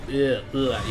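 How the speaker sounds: rounded Swedish grunting vowels; Swedish-accented vowel grunts; Swedish vowel sound. Shown as a demonstration. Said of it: "I sound very Swedish".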